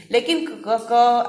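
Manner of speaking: क said as a throaty fricative; क slides in the throat like Urdu q; nukta क. Shown as a demonstration. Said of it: "थोड़ा सा फिसलता हुआ सा लगता है". (क़ को फिसलता हुआ बोलें)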